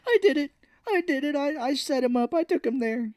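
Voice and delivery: very high, hammed-up pleading; mock panicked confession. Man confessing as the hammer comes out, mock panic. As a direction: blurt it out high, imitating desperation.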